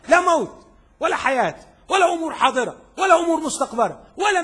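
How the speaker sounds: high, loud triumphant proclamation; full-force preaching. Acted. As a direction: hammer out each item from the pulpit.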